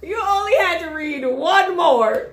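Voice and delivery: loud, high, slow through laughter; laughing tease. Real, not acted. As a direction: tease while cracking up. Laughing teasing over the accent.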